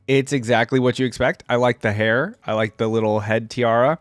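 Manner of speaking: usual speaking voice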